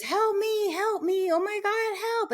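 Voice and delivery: a hint of a terrified woman screaming; retold panicked scream. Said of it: "a woman screaming".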